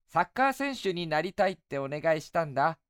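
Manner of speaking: put-on high, eager young boy. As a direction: play a cheerful little boy. Boy answers.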